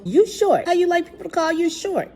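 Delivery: high-pitched, needling challenge; retold confrontation in a high voice; mock taunt. Teacher confronts little boy in retold scene.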